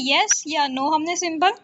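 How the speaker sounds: ordinary talk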